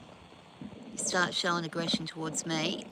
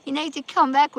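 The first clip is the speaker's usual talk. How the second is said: retold calling out; 8 semitones higher, 8 dB louder than usual. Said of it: "And then they're just like".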